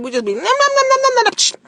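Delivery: high nom nom eating noises; mock munching. Aunt teasing chatty child who kept eating, high munching sounds. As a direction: play a greedy little eater. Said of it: "She said".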